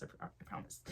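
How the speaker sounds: whispered promise, almost no voice. Real, not acted. Vlogger promising viewers she is no arsonist, in a whisper. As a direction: breathe it as a joking promise.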